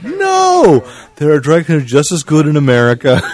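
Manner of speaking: shrill high outburst, then loud protest; hammed-up indignant yelp; mock outraged shout. Mock outburst over dry cleaning.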